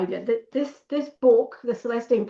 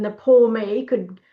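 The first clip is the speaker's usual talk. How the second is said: ordinary talk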